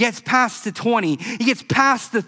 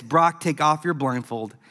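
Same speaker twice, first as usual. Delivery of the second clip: calm reassurance; 5 semitones lower than usual. Retold or imitated. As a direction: tell him gently he made it. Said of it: "The coach said this".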